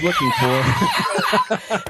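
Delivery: very high, slow, laughing; laughing squeal. Real, not acted. Comedian laughing at his own punchline. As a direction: let the laugh push the voice up.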